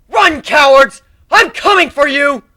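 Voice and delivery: loud, high threatening shout; menacing roar. Acted. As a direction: roar at the fleeing swarm. Threatens fleeing enemies.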